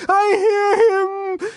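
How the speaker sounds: very high, loud, drawn-out wail; piercing cry of revelation; ecstatic cry. A narrator's reading. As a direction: cry it out slowly at the top of the voice. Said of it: "she cried".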